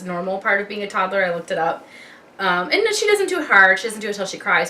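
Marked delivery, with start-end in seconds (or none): none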